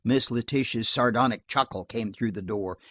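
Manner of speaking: usual speaking voice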